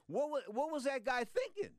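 high-pitched disbelief at the referee; incredulous. Real, not acted. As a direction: ask it as if you can't believe it. Host outraged at the referee.

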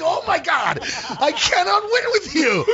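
high, laughing "I give up"; laughing exasperation. Retold or imitated. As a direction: throw up your hands while laughing. Exasperated at friend.

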